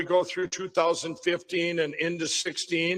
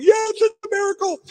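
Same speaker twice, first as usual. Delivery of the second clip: much higher, loud, overjoyed exclaim; ecstatic; 14 semitones higher, 6 dB louder than usual. Retold or imitated. Man answers his seatmate on the plane, overjoyed. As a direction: burst out with wonder.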